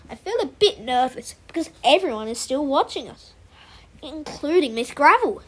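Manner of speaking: ordinary delivery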